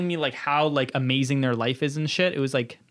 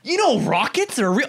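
mock conspiracy guy; 10 semitones higher than usual.

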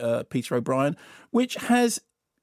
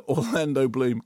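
ordinary delivery

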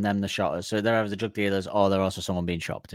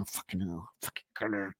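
muttered curse; 2 semitones lower, 14 dB quieter than usual. Retold or imitated. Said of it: "He's going".

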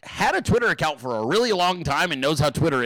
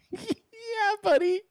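laughing; 13 semitones higher, much slower than usual. Real, not acted. Laughing reaction.

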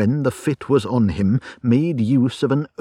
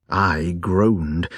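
ordinary delivery

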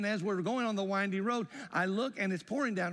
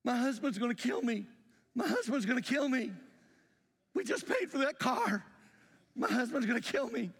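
crying; 8 semitones higher than usual. Retold or imitated. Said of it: "She's crying".

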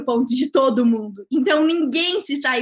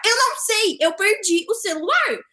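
higher, insistent feigned bewilderment; playing clueless; 4 semitones higher than usual. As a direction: protest as if genuinely clueless, hammed up. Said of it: "me dei uma de louca aqui".

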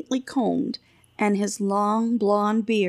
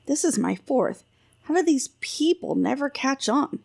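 usual speaking voice

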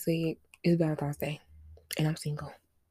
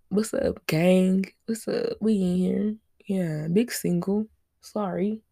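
ordinary talk

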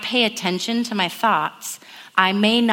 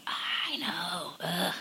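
whispered, beaten-down sigh; defeated whisper; 4 semitones lower, 14 dB quieter, much slower than usual. Retold or imitated. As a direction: breathe it out, deflated. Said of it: "And we're like".

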